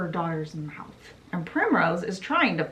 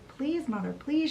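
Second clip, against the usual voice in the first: retold pleading yell; 7 semitones higher than usual. Daughter begs her mother, high mock-yelled plea. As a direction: plead in a raised pitch, mildly acted. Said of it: "Gothel yells at her mother".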